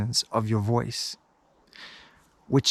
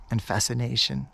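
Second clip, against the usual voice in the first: soft trance-inducing guidance; hypnotic voice; 4 dB quieter than usual. Acted. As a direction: lull them gently. Hypnotic delivery held.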